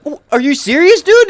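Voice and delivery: loud, high disbelieving shout; exasperated yell. Retold or imitated. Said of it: "you're like".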